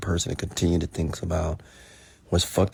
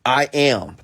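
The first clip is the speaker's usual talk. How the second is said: louder, higher, repeated mock protest; challenging insistence; 5 semitones higher, 5 dB louder, slower than usual. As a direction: dig in like a stubborn arguer. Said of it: "they will challenge me".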